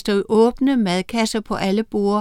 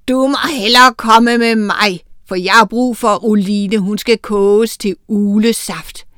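louder, sinister witch voice; wicked witch; 6 dB louder than usual. Acted. Oda the witch gloating to Miranda, loud and sinister.

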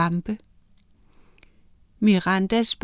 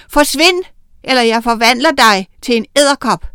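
loud, fast threat; shouting threat; 3 semitones higher, 7 dB louder, faster than usual. Acted. Miranda threatening Oda with the wand, loud and fast. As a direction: yell it at the witch. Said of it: "Hun pegede på Oda og råbte".

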